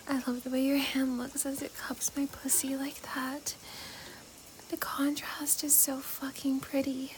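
soft, husky whisper up close. Acted. Whispered intimate roleplay.

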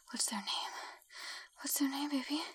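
ordinary talk

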